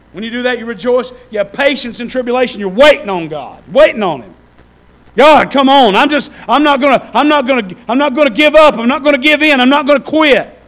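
high and loud, building to a cry; climbing, shouting prayer; fervent preaching. Real, not acted. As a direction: preach it out, refusing to quit. Preaching at full force.

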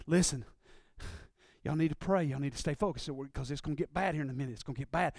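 low, hushed urgent warning to the disciples; quiet urgent warning, fast at the end. Retold or imitated. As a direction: keep it low and urgent, a warning before trouble. Jesus warning disciples, retold.

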